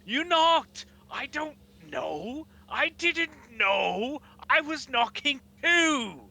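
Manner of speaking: high, shrill-edged shouting at her partner; furious elven woman. Acted. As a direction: scold him like he ruined everything. Elven woman snaps at the Goliath.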